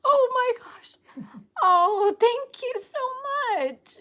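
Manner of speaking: high, loud, crying thanks; overwhelmed, sobbing out thanks. Real, not acted. Overwhelmed greeting fans.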